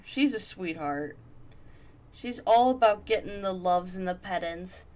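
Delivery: usual speaking voice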